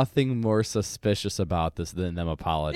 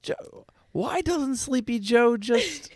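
high, slow mocking pundit voice; drawn-out, sneering Tucker Carlson parody; Tucker Carlson impression; much slower than usual. Cohost mocking Biden as a TV pundit, high and slow. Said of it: "Tucker Carlson, like".

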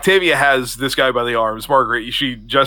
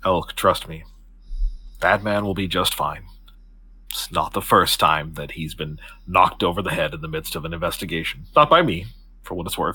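low, even, formal reassurance; calm him with a lowered, measured voice; composed Octavia, reassuring; 6 semitones lower, 4 dB quieter than usual. Acted. Octavia assures Elk the officer will be fine, composed.

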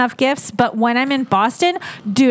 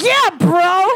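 higher, louder, lazy "yeah bro"; 7 semitones higher, 3 dB louder than usual. Retold or imitated. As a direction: toss off a chill bro agreement. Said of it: "I'm just like".

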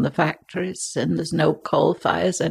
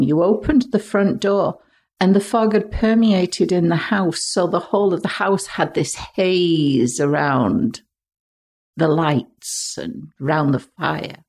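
ordinary talk, nothing marked

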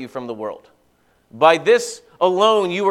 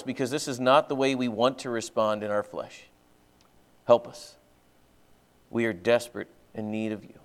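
low, subdued praying voice with pauses; earnest prayer; 4 semitones lower than usual. Real, not acted. Pastor asking the Heavenly Father for understanding, earnest prayer. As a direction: pray humbly, lower than preaching voice.

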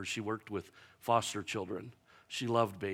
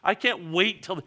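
a hint of impatient Martha; 10 semitones higher, 6 dB louder than usual. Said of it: "She goes".

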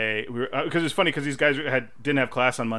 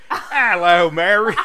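higher, louder mock cockney; hammed-up cockney accent; 5 semitones higher, 9 dB louder, slower than usual. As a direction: do a cheesy cockney greeting.

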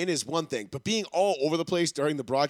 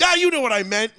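mock drunk uncle; 5 semitones higher, 6 dB louder than usual. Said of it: "drunk rambling uncle".

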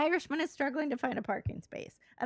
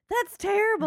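loud, high cry of dismay; mock dismay; 9 semitones higher, 8 dB louder than usual. Patient hears the doctor's bad news, mock dismayed. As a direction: exclaim it as the appalled patient.

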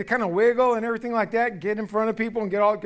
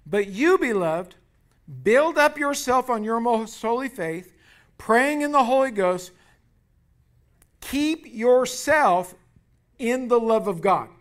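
slow, raised scripture reading; preaching scripture; 4 semitones higher, 6 dB louder, slower than usual. Acted. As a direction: read the verse with weight.

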